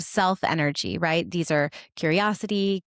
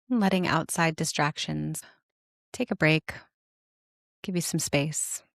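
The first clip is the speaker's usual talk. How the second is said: guided meditation; 3 semitones lower than usual. Acted. Host inviting listeners to notice their parts, guided meditation. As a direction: guide them inward, unhurried and calm, settling lower than chatty talk.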